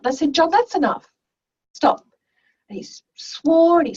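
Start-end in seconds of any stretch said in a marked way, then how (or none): 0.3-2.1 raised-pitch retold teacher telling-off; firm reprimand. Teacher pulls up an angry student, firm. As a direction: cut the student off firmly.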